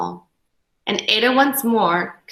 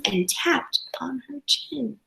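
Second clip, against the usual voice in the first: unvoiced soft whisper; 3 semitones higher, 9 dB quieter than usual. A narrator's reading. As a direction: whisper while she scribbles. Whispered narration line.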